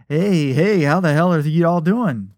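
loud, high-pitched call; shouted greeting. A narrator's reading. Friend barges in on the two, shouting. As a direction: boom out a hearty hey across the crowd. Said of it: "he shouted".

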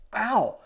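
sudden high, loud cry of pain; pained yelp. Acted. Assam yelping in sudden pain. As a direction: yelp as a spike hits the brain. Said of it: "He put his hand to his forehead".

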